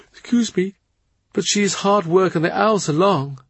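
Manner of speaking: lightly voiced young woman. A narrator's reading. Alice voiced in reading.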